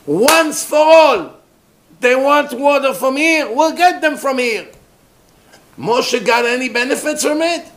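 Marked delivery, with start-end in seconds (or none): 0.1-4.7 high, forceful declaration; impassioned, raised-voice determination; emphatic resolve. Retold or imitated. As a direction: declare it once and for all. Acting Moshe's resolve to silence scoffers.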